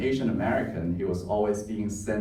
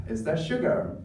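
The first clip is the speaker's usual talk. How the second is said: naive, higher-pitched asking; innocent question; 6 semitones higher, 3 dB quieter than usual. Retold or imitated. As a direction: ask it in all innocence, lifting the pitch. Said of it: "I asked innocently".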